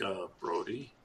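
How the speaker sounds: nervous date answering, very soft; timid boy. Retold or imitated. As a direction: shrink back like a nervous teen. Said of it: "He's like".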